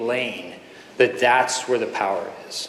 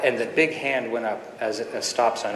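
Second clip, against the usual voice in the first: normal talking voice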